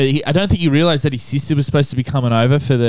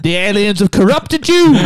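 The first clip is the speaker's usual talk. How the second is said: higher, louder mock preacher dad; hammed-up preachy dad; 6 semitones higher, 4 dB louder than usual. Friend mocks the zealot dad, high and loud. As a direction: ham up a zealot father's outrage.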